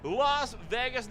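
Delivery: slow, high, stretched Las Vegas; announcer drawl. Acted. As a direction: draw out Las Vegas like a big reveal. Announces next venue with flourish.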